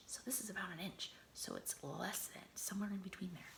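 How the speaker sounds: fast hushed whisper; whispered aside while showing size. Acted. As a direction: point and whisper it. Showing an inch on page.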